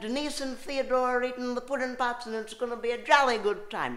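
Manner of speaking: put-on high, loud jolly British accent; chirpy mock British toff accent. Comic doing a jolly British impression.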